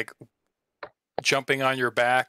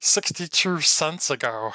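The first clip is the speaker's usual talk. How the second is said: normal talking voice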